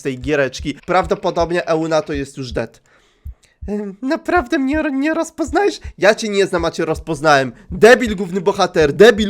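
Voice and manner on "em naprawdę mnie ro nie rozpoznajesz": creepy caller voice. Acted. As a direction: purr it like a creepy stalker. Głos stalkerki. (wysoki, cichy, złowieszczy głos dzwoniącej)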